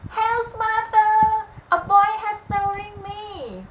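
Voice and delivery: shrill loud cry for help. A narrator's reading. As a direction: cry out in panic as the harp. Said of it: "the magic harp cried".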